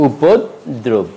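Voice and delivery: উপদ্রব split as "upod... drob"; two-part drawn-out উপদ্রব; slow correct pronunciation. Shown as a demonstration. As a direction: slowly break উপদ্রব before "drob". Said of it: "শব্দটির উচ্চারণ হবে".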